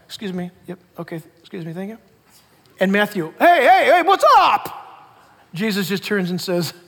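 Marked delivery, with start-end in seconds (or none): 0.1-2.0 polite quiet. Acted. Jesus slipping quietly through crowd. 3.4-5.1 cheery greeting. Acted. Matthew greets Jesus brightly.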